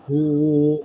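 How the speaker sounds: usual speaking voice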